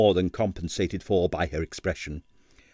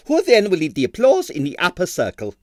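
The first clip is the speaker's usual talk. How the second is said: higher, indignant impresario voice; excitable impresario; 8 semitones higher, 4 dB louder than usual. Acted. Impresario refusing Stanley time off, higher and indignant. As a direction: protest like a fussy ballet boss. Stroganoff in character voice.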